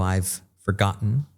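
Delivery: "I've forgotten" with the T caught in the throat, slow; stop T. Shown as a demonstration. Teacher demos the stop T slowly. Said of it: "se llama la stop T".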